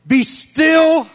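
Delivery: loud high bark of be still; mock shouted command. God ordering stubborn people, shouted. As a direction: yell it like a fed-up father. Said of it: "He's like".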